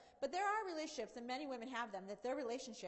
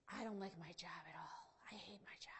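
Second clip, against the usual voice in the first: whispered, low, moping complaint; glum whisper; 5 semitones lower, 7 dB quieter than usual. Retold or imitated. Friend moping about her job, whispered and down. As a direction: whisper it like a gloomy confession.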